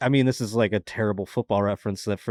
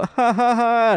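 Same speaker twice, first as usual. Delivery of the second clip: high, loud fake polite laugh; forced laugh; 14 semitones higher, 8 dB louder, slower than usual. Laughing at the cop's dad joke, forced and high. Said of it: "do I laugh at the joke".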